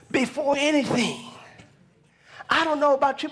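preaching. Real, not acted. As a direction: testify to the congregation in a high preaching voice.

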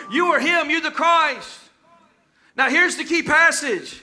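ordinary delivery

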